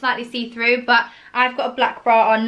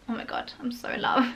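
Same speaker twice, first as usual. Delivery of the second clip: breathy whispered gush; whispering; 9 dB quieter than usual. Real, not acted. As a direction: whisper it, lovestruck. Gushing over shoes, hushed aside.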